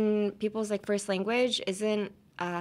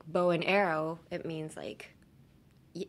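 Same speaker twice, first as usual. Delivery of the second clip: ordinary delivery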